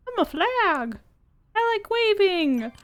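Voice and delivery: high-pitched silly flag voice; talking flag. Pretend. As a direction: pipe up high as a cheerful cartoon flag. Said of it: "Flag, what do you think?".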